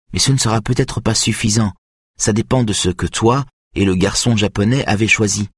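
a hint of a nervous girl doubting herself; young violinist Greta, unsure. A narrator's reading. Greta voiced by narrator.

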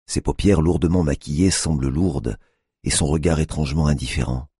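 ordinary delivery, nothing marked

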